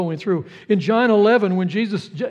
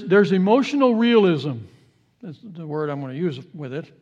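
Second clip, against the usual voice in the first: usual speaking voice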